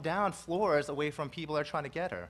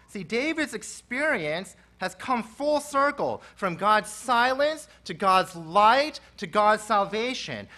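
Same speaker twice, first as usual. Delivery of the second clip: full-force preaching; 3 semitones higher, 7 dB louder than usual. Acted. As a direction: preach it out to the back row.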